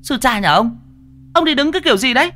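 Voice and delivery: very high-pitched indignant woman; angry woman scolding. A narrator's reading. Woman to the man who bumped her, angry scolding. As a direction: scold him in a shrieky falsetto.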